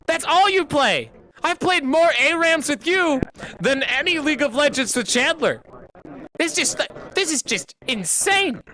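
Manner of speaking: high frustrated shouting; angry yell. Real, not acted. Streamer yelling at a friend blocking his ending, frustrated shout. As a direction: yell it out, fed up and desperate. Ranting at friend about aram.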